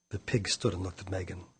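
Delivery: plain reading voice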